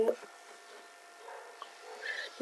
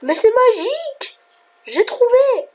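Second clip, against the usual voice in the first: high, drawn-out jubilant exclamation; gleeful high-pitched marvelling; excited wonder; 11 semitones higher, 3 dB louder, slower than usual. Real, not acted. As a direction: celebrate a discovery way up high. Celebrates finding how it works.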